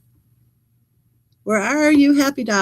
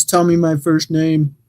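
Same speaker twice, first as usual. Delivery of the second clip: man's voice; 6 semitones lower than usual. Retold or imitated. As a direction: drop into a lightly faked gruff guy. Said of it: "I'm saying that in a man's voice".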